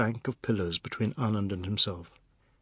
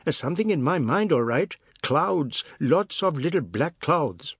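higher, louder and quick, half mocking; quick high-pitched voice; 4 semitones higher, 4 dB louder than usual. A narrator's reading. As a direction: rattle it off high and sharp. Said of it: "in his quick, high-pitched voice".

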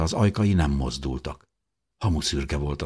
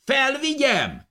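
high, slow, drawn-out call; slower than usual. A narrator's reading. Dávid offers to bring it up, shouting. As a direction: stretch the shouted question up the shaft. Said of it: "kiáltotta fel Dávid".

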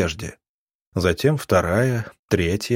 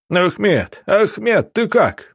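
high-pitched yell, moderately raised; desperate yell; 10 semitones higher, slower than usual. A narrator's reading. Mikhail calling to fallen Akhmed, high alarmed yell. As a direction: cry out the name high and urgent. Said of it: "завопил Михаил".

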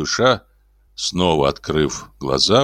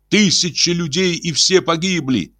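excited cry; 11 semitones higher than usual. A narrator's reading. Mark remembered crying out.

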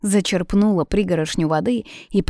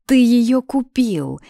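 stubborn accusation, pitched up at first; 6 semitones higher, 4 dB louder, slower than usual. A narrator's reading. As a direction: dig your heels in and accuse. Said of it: "заупрямилась она".

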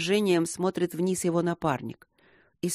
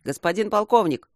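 raised, higher-pitched hail; calling out; 4 semitones higher, 5 dB louder than usual. A narrator's reading. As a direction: call urgently for the colonel. Telephonist calls out from the hatch.

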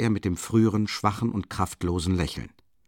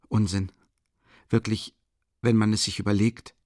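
usual speaking voice

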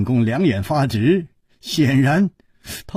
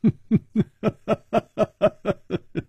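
light laugh; 7 semitones higher than usual. A narrator's reading. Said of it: "淡淡的说".

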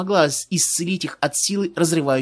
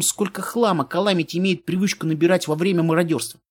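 ordinary delivery

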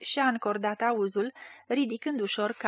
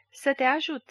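higher, lightly sugared offer of help; honeyed; 4 semitones higher than usual. A narrator's reading. Schemer offers help to the blind man, honeyed. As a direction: coax him with a touch of honey. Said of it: "răspunse mieros tărcatul".

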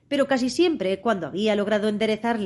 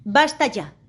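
a raised, higher shout; 5 semitones higher, 5 dB louder, slower than usual. A narrator's reading. Queen stops the bowing gardeners, shouting. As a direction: cut them off loudly. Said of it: "gritó la reina".

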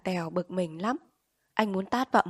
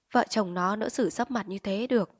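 ordinary delivery

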